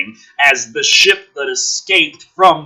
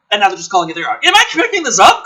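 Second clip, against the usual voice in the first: ordinary talk, nothing marked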